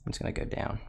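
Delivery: playful whisper on down; voice drops to whisper. Shown as a demonstration. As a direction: sink into a whisper as the graph drops. Acting out the graph moving.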